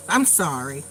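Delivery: lightly voiced child, low and soft; imitated apology. Child apologizing to Mima after teasing her, imitated low and soft. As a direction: drop low and soft for a sheepish sorry.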